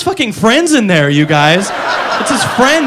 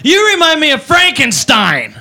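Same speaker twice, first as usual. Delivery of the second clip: put-on drunk girl; 4 semitones higher than usual. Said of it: "She goes".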